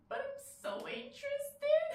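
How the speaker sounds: very high, giddy squeal; giddy confession. Acted. Woman admitting she only pretends to be cool, giddy. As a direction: let the excitement burst out in a high pitch. Admits her hidden excitement.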